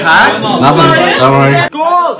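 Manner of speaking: loud, high party yelling. Real, not acted. Drunk rowdy shouting at party.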